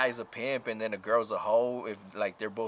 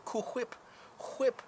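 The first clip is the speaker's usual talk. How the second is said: Family Guy quote; 12 semitones higher, 4 dB quieter than usual. Retold or imitated. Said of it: "that Family Guy episode".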